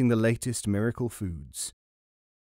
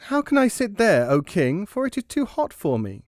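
high squeaky mouse begging the king; pleading high mouse voice; 8 semitones higher, 4 dB louder than usual. Acted. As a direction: plead up high, a little louder. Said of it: "He appealed to the king".

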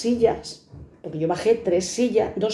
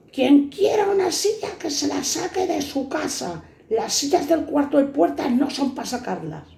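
sergeant snaps; 8 semitones higher than usual. Retold or imitated. Sergeant scolding the guard about the chairs, mock rant. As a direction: lay down the law shrilly like a petty boss. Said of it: "Y salta el brigada".

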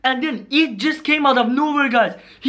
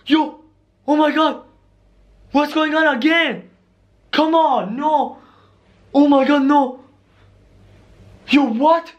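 higher, agitated exclamations; alarmed; 4 semitones higher than usual. Acted. Vlogger reacting to strange goings-on, alarmed. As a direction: blurt it out in rising alarm. Acted alarm as something happens again.

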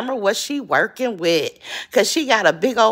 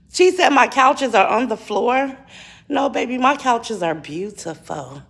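usual speaking voice

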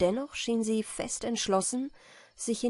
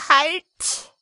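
thundering high shout; booming thunder voice; 9 semitones higher, 11 dB louder than usual. A narrator's reading. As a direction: thunder the word out. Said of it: "rief der Räuber mit donnernder Stimme".

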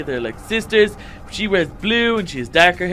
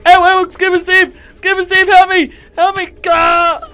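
much higher and louder, yelping in pain and terror; panicked screams; 11 semitones higher, 10 dB louder than usual. Acted. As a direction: scream for help as they jump you. Attacked, screaming for help.

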